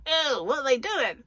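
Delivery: high, disgusted put-on voice; squeal-free but high-pitched mock disgust as the cat. Voicing a cat watching its owner eat chocolate, high mock-disgusted voice. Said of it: "that voice works better than mine".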